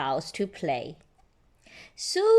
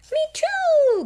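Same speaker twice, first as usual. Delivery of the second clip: very high, loud squeak; high squeaky agreement; little mole voice; 7 dB louder than usual. Acted. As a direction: chirp it eagerly. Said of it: "Grace Molly Mole".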